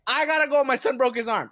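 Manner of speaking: high, loud, urgent; mom on phone. Retold or imitated. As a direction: hurry off the phone. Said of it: "she tells the person ... in Spanish".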